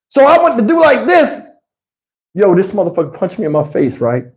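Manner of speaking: ordinary talk, nothing marked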